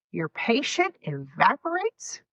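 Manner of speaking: usual speaking voice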